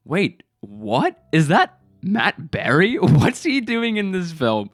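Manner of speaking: stunned disbelief. Retold or imitated. Viewer recognising Matt Berry in the film, incredulous. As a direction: double take climbing into high, drawn-out questions. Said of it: "made my jaw drop in, like, shock and awe".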